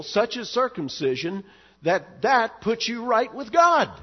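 building to a loud, high climax; preaching at full force. Acted. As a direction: hammer the list home from the pulpit.

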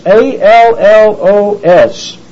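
"A L L O S" spelled letter by letter, slowly; spelling out allos. Shown as a demonstration. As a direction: spell "A L L O S" out, one slow letter at a time. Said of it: "It's allos".

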